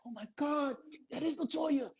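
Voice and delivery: high-pitched fan excitement; imitated starstruck amazement. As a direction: replay the awe of spotting a star. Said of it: "looked and said".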